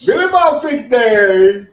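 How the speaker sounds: high, loud, slow hillbilly voice. Acted. Joking about a cousin in the KKK, loud slow hillbilly voice. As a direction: ham up a backwoods yokel, stretching it out.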